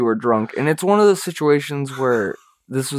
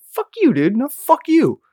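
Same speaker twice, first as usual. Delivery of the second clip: playful mock insult; 11 semitones higher than usual. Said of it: "So it wasn't so angry".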